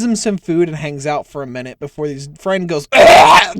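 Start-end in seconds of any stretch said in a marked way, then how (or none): 2.9-3.5 a strangled, breathless choke; choking gasp. Acted. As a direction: choke on the food mid-breath.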